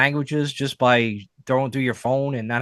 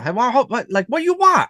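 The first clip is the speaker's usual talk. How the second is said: much higher, loud impatient voice; restaurant worker voice; 12 semitones higher, 5 dB louder than usual. Retold or imitated. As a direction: bark the order questions high and fast. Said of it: "they're both like".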